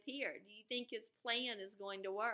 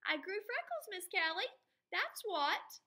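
put-on much higher, cheeky kid voice; defiant boy; 12 semitones higher, 6 dB louder than usual. Andrew insisting to Miss Kelly he grew freckles, much higher and cheeky. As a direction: answer back proudly, like a cheeky boy.

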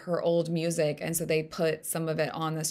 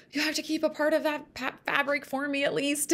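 mock freak-out; 8 semitones higher than usual. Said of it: "I totally freaked out".

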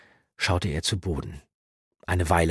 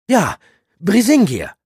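loud, much higher incantation; spell word; 13 dB louder than usual. Acted. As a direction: cast the word. Zauberwort ausgesprochen. (Zauberwort, laut beschworen)

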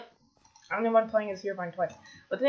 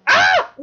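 very high, loud shriek; startled scream; 15 dB louder than usual. Real, not acted. Player caught out mid-game, startled scream. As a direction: shriek in sudden fright.

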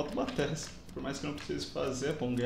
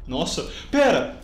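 susto; 9 dB louder, slower than usual. Real, not acted. Susto com outro jogador.

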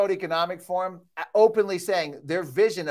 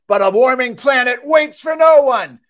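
full-throated shout, much louder and higher; screaming; 5 semitones higher, 11 dB louder than usual. Real, not acted. Speaker to audience, worked up, shouting. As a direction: yell it out, worked up. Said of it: "I'm sorry for screaming".

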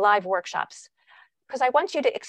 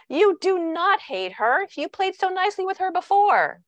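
put-on parent contradicting child; 10 semitones higher than usual. Parent denying a child's hatred of the little sister, high insistent correction. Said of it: "Imagine saying to a child".

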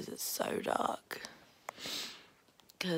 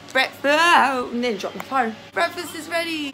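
much louder call across the house; loud breakfast call to the family; 7 semitones higher, 14 dB louder than usual. Real, not acted. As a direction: shout that breakfast is ready.

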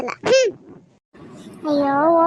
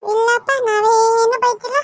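put-on innocent little girl; 3 semitones higher, slower than usual. Child denies scolding grandma.

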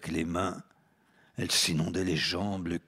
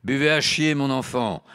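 loud jeer; 7 semitones higher, 7 dB louder than usual. Acted. Count answers girl.

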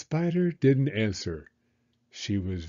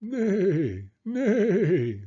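high wavering neigh; horse whinny; 8 semitones higher than usual. Acted. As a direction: neigh like a horse, voice wobbling downward. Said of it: "said the horse".

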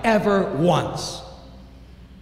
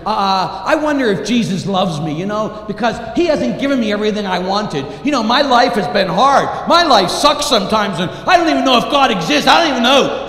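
higher, louder self-pitying whine; whiny complainer; 5 semitones higher, 6 dB louder than usual. Retold or imitated. Doubting believer whining that God let him down, high and loud. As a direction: complain like life owes you. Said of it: "sometimes we wonder".

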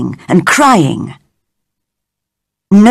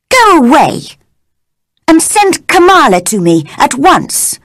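higher, cross command to a servant; cross order; 7 semitones higher than usual. A narrator's reading. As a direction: snap the order at her, higher. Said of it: "she asked crossly".

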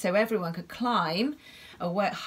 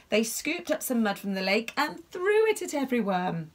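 ordinary delivery, nothing marked

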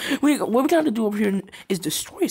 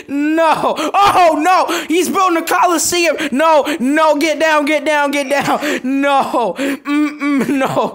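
high, loud frantic yells; panicked shouting; 7 semitones higher, 5 dB louder, slower than usual. Real, not acted. Gamer shouting at an enemy building a Coliseum. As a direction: yell in alarm at the screen.

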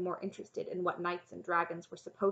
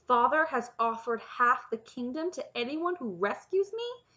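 high, louder disbelieving question; incredulous; 5 semitones higher, 6 dB louder than usual. A narrator's reading. Princess hearing of her father's reward, incredulous. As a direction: climb in pitch in utter disbelief. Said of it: "Cimmerian said incredulously".

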